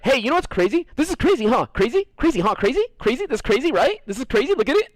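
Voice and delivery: high-pitched mock pestering, each phrase rising; teasing imitation. Comic imagines someone harping on the word crazy, high-pitched teasing. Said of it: "you're gonna be like".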